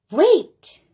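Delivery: high, loud burst of realization; sudden excited cry. Acted. Child narrator suddenly finding the perfect costume, loud and excited. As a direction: shout it as the idea hits.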